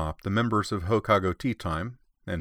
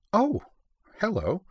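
much higher "Oh" in sudden surprise; surprised; 12 semitones higher, slower than usual. Acted. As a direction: caught off guard by the dog. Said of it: "she added, surprised".

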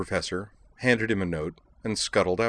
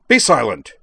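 loud, higher, angry snap; snapped command; 4 semitones higher, 11 dB louder than usual. A narrator's reading. Professor silences the robot student mid-sentence, snapping. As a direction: cut her off sharply and loudly. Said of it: "Harris snapped".

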